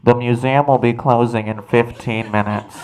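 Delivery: echoing stadium announcer; PA announcement. Retold or imitated. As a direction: boom it over the loudspeaker. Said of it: "a P A voice come over and go".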